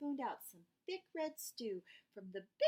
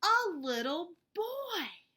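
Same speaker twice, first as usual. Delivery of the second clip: dramatic reveal; 8 semitones higher, 8 dB louder, slower than usual. A narrator's reading.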